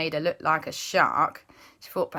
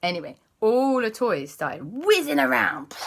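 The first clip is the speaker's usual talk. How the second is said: plain reading voice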